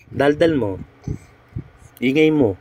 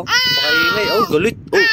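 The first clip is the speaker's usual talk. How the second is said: put-on much higher, teasing baby-talk voice; squeaky baby talk. Mother teasing her angry baby in baby talk.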